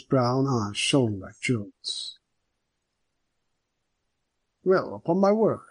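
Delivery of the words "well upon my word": higher, hearty police superintendent; genial superintendent. A narrator's reading. Superintendent welcoming Hanno at the door, hearty voice. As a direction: greet an old friend warmly.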